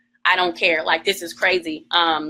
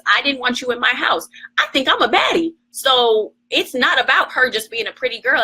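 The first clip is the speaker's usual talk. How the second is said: ordinary talk, nothing marked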